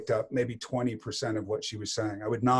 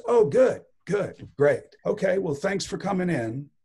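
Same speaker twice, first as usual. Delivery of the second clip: mock-cheerful, high and loud dismissal; upbeat brush-off; 8 semitones higher, 5 dB louder than usual. Director politely ends an actor's audition, upbeat brush-off. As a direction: imitate a director's bright, high-pitched thanks.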